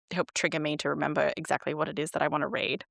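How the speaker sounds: ordinary talk, nothing marked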